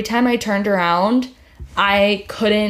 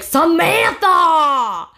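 Samantha stretched long and higher, a mild mock scream; mock ghostly scream; 7 semitones higher, 3 dB louder, much slower than usual. Retelling a woman's voice screaming Samantha in her ear, mild mock wail. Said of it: "screaming in my ear".

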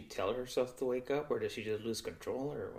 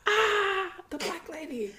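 high-pitched hammed-up scream; mock terrified squeal, then the words; mock scared reaction; 4 dB louder, slower than usual. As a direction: play-act a panic, pitched way up. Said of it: "that'd be my reaction".

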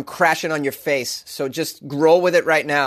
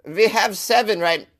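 mock German villager; 5 semitones higher, slower than usual. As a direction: play a German villager startled mid-count. Acts out villager Helmut answering.

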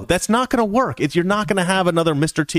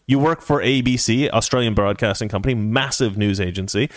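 ordinary delivery

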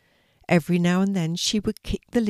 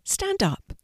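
high, eager command; excited. A narrator's reading. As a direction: burst out with the idea. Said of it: "she said excitedly".

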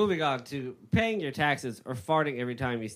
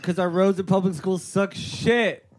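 raised, higher, heated rant; 7 semitones higher, 6 dB louder than usual. Pretend. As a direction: argue it loud and high. Heated mock argument.

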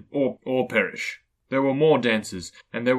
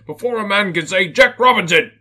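big booming jolly merchant, loud and high; oily, rich, fat, jovial voice; 7 semitones higher, 8 dB louder than usual. Acted. Fezziwig calls his apprentices, loud and jovial. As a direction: boom it out like a fat, merry old boss. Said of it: "cried old Fezziwig".

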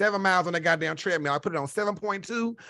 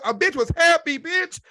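ordinary delivery

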